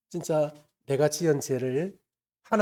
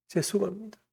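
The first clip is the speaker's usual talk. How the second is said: soft, choked first apology before the sobbing breaks; tearful apology; 4 semitones higher, 5 dB quieter than usual. Retold or imitated. Boy at camp apologizing to Jesus, choked and tearful. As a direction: hold the tears back, barely getting it out. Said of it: "하면서 막 엉엉 우는 거예요".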